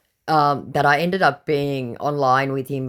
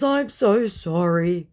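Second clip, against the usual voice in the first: mock sorry; 6 semitones higher, slower than usual. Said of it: "Sad face".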